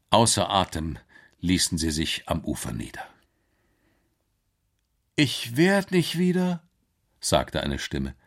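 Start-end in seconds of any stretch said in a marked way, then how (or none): 5.2-6.6 high-pitched onlooker's amazement; amazed bystander; astonished. Acted. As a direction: voice disbelief, pitched up. Astonished onlooker spots the monster.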